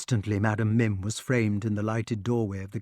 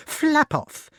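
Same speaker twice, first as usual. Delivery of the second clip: very high, irritated dismissal; witch crying out; 4 dB louder than usual. A narrator's reading. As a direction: shoo it off in a witchy cry. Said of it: "cried Madam Mim".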